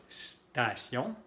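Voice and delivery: "Station" begun directly on a long hissed S; snake-like S. Shown as a demonstration. Teacher drilling learners, long snake S opening "Station". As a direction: hold the S of "Station", no E before it. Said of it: "la S que se pronuncia como la serpiente".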